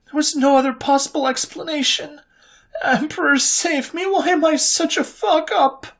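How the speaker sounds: high, anxious, spiralling self-doubt; anxious young woman doubting herself; anxious inner voice. A narrator's reading. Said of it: "her anxiety had hit an all-time high".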